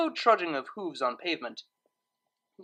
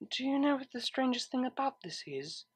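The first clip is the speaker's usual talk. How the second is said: whispered; 7 semitones higher, 6 dB quieter than usual. A narrator's reading. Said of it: "whispered Octavia".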